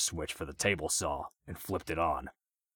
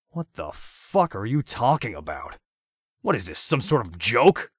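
louder, higher defiant retort; 5 semitones higher, 8 dB louder than usual. A narrator's reading. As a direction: throw it back in his face. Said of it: "said in defiance".

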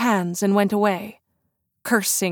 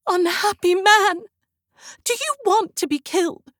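high pitched, frightened cry; terrified plea; 11 semitones higher than usual. A narrator's reading. Christine frightened, warning Raoul he could die. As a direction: plead with him in terror. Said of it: "showing every sign of unspeakable terror".

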